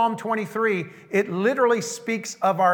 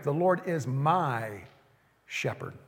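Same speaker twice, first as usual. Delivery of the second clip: hushed declaration; 5 semitones lower, 6 dB quieter than usual. Real, not acted. Quiet declaration in sermon.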